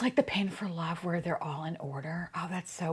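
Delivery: soft whisper of disappointment; whispered bummed aside; whispered realization. Real, not acted. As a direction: breathe it out as an aside. Muttering a realization.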